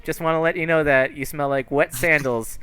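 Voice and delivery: put-on cheeky kid. Kid mocks the lady's perfume while dragged off, cheeky. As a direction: needle them like a mouthy child. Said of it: "And he's like".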